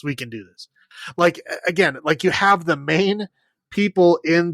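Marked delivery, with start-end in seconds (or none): 0.0-0.7 much lower and much softer, the words trail away; mock pep talk, fading. Imitating bad actors psyching themselves up, quiet mock. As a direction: say it again under the breath. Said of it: "They're like".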